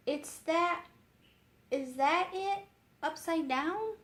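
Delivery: put-on a curious girl asking; young girl. A narrator's reading. Elizabeth voiced.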